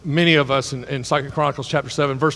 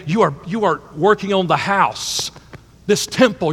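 ordinary talk, nothing marked

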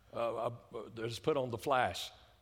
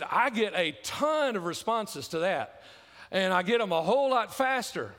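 raised, louder sermon voice; loud preaching; 6 semitones higher, 6 dB louder than usual. Acted. Preacher retelling the online forum story to the congregation, loud. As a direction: push it out with preacher's force.

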